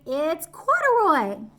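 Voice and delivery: eager reveal; excited. Real, not acted. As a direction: announce it with delight.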